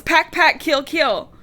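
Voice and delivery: panicked yell. A narrator's reading. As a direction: shout it like a waking nightmare. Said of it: "she yells".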